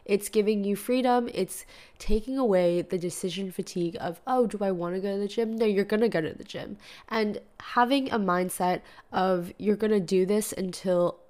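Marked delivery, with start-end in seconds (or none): none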